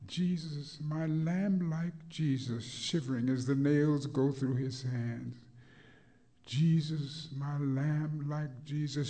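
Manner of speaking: chanting sermon with swelling peaks; preached poem. Acted. Rhythmic preached poem throughout.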